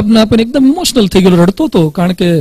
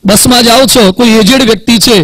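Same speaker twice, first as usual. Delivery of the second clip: ordinary talk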